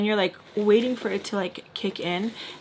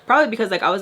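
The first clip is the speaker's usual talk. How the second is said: ordinary talk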